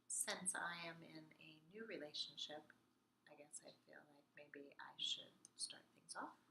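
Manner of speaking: soft unvoiced whisper.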